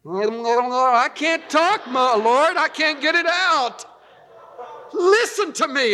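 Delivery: high, stretched, stumbling plea; stammering Moses. Retold or imitated. Moses pleading with the Lord, high and faltering. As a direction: struggle to get the words out. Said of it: "That's the way I see Moses doing it".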